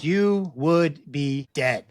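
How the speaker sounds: slow, weighty, word by word; dramatic doctor. Retold or imitated. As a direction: deliver the verdict slowly as the doctor. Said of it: "there was a real dramatic pause".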